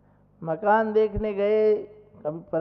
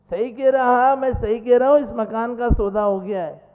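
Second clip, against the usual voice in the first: insisting loudly; 6 semitones higher, 10 dB louder than usual. Retold or imitated. Son insisting to his father.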